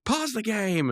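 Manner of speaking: high-pitched mock outburst; indignant Rigby. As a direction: imitate an indignant gamer calling a halt. Retold indignant demand to pause.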